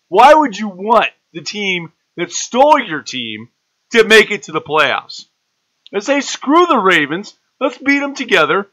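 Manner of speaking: high, loud, exasperated rant at fans; heated rant. Real, not acted. Lecturing Browns fans on rooting for Baltimore, a loud rant. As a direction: lay into them, voice climbing and loud. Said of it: "So it's rant time".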